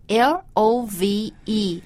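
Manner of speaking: slow letter-by-letter L O V E; spelling it out. Shown as a demonstration. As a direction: spell L O V E slowly, one letter at a time. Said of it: "我们已经学过这个单词很多遍了。".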